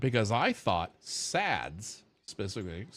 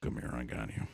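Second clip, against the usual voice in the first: lower, softer, tender call; soft comforting; 12 semitones lower, 8 dB quieter than usual. Retold or imitated. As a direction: pull them in gently, reassuring. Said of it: "I'm like".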